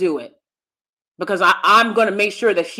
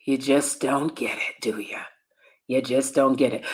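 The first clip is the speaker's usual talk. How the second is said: knowing scoff; 8 semitones lower, 7 dB quieter than usual. Retold or imitated. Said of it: "And I was like".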